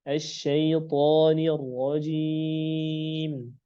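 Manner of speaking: slow recitation, heavy "tho" in SYAITHANIR; thick tho. Shown as a demonstration. Teacher recites the example, slow and heavy on "syaithanir". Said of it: "huruf to dia harus tebal".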